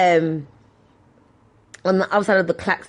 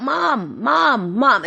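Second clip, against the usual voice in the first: high singsong call for mum; half-volume calling-out; mock shout; 6 semitones higher, much slower than usual. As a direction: call out for Mum across the shop, without full force. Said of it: "I always shout".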